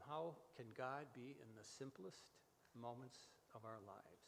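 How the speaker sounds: usual speaking voice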